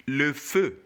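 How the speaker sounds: "le feu" as luh fuh, rounded vowel; "le feu" in careful French, lips rounded; French model pronunciation. Shown as a demonstration. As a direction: model "le feu" clearly for a learner.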